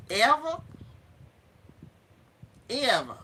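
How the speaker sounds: Southern drawled quote, punched loud; emphatic Southern-accented one-word punch. Acted. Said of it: "saying it with a Southern accent".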